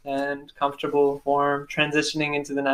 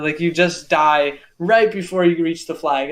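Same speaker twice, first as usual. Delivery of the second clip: plain reading voice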